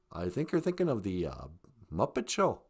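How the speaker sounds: very low, soft second voice; voiced partner. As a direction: drop deep and quiet to answer. Second voice answers the question.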